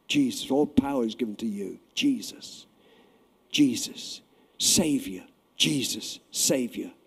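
soft, reverent naming of Jesus; hushed devotional whisper-like prayer; quiet prayer. Shown as a demonstration. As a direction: murmur it under your breath. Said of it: "to yourself, say it first".

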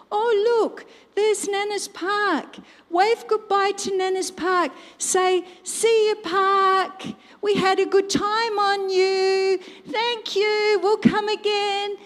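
put-on high, singsong talk for little children; nana to toddlers. Nana urging grandsons to wave at the park, singsong. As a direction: coo brightly as if to small children.

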